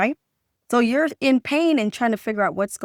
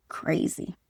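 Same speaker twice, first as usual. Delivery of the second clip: whispered aside; 6 semitones lower, 9 dB quieter than usual. Retold or imitated. What body is not saying.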